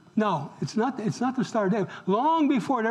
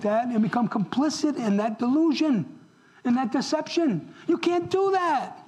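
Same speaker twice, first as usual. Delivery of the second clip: raised, insistent pulpit delivery, pitched higher; preacher rebuking the congregation, higher and insistent; forceful preaching; 5 semitones higher than usual. Acted. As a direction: drive the point home to the congregation. Preaches the point hard.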